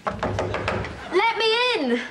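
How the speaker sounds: high, drawn-out wail at the door; long wailing plea. Acted. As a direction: wail it slowly, begging to be let in. Locked-out mum wailing at door.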